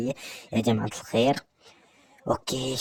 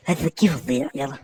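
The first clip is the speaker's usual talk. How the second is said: usual speaking voice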